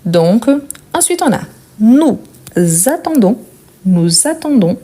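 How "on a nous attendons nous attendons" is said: ordinary talk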